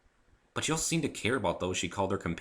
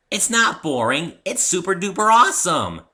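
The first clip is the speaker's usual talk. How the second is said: much higher and louder, gushing; giddy Pinkie; 11 semitones higher, 11 dB louder than usual. Acted. Pinkie insisting to Rainbow the story is awesome, gushing. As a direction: bounce with excitement.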